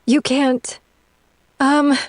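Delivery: high, faltering panic; panicked. Acted. Woman caught talking to the demon, panicked. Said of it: "she says in a panicked voice".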